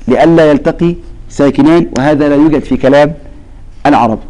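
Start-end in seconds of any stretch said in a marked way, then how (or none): none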